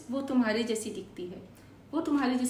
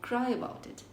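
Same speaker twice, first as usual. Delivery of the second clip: slow and clear, repeated twice; slow teacher repeat; slow model sentence; much slower than usual. Shown as a demonstration. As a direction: drill it slowly twice. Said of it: "हम बोलेंगे".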